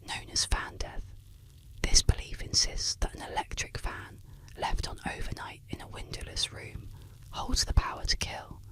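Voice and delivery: plain reading voice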